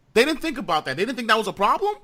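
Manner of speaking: can't believe they missed it; incredulous. Real, not acted. Repeats it in disbelief.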